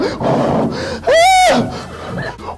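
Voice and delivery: sharp high labour gasp; mother on the table gasping in labour. Acted. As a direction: gasp high as the birth hits. Mother giving birth.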